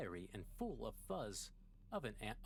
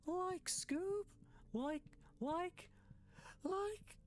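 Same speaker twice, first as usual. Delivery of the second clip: much higher, stretched rising "like" in a goofy cartoon voice; Shaggy impression; 13 semitones higher, slower than usual. Gamer tries a self-admittedly bad cartoon voice, squeaky. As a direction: attempt a squeaky slacker cartoon voice. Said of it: "I can't do a good Shaggy impression".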